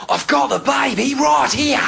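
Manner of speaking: high, drawn-out, exaggerated Australian shout; Crocodile Hunter. Man by a river with a croc, exaggerated Aussie holler. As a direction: holler it like an over-the-top Aussie showman. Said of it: "no Australian spoke like that".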